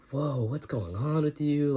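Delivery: high, slow startled whoa; hammed-up surprise; mock shock. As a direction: act stunned at what you see. Visitors shocked by father.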